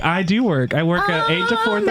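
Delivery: high squeal of a reply; mock campy reply. He answers city gays in a high camp squeal. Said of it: "I'm like".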